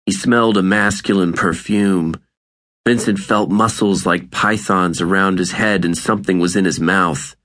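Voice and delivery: usual speaking voice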